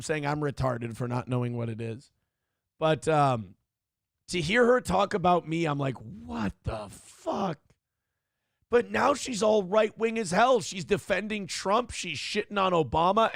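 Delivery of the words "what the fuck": drawn-out, higher, hushed disbelief; stunned reaction. Retold or imitated. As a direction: re-enact being stunned, stretching it out softly. Acts out own stunned reaction.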